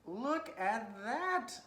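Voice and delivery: drawn-out delighted exclamation; slow, high, thrilled show-off; excited. Real, not acted. Proud of cut rectangle.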